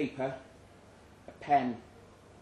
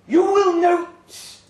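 much higher, louder and slow, lecturing; a monster surgeon addressing its audience; slow, loud rasping creature announcement; 12 dB louder, much slower than usual. Acted. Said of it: "said a rasping voice".

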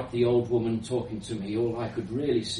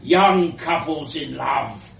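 put-on elderly foreign woman; 3 dB louder than usual. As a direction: dismiss them in a foreign accent. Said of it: "she continued".

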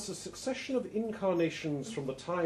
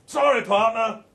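higher, louder, breezy apology; Harry brushing it off cheerfully; Harry's voice; 7 semitones higher, 11 dB louder than usual. Acted. Harry apologizing to his bridge partner, breezy and loud. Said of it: "says Harry".

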